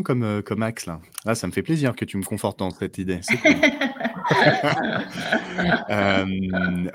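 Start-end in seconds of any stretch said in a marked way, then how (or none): 3.5-5.8 long high bursting laugh; delighted laugh. Real, not acted. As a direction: laugh out loud with joy. Pleased by the agreement.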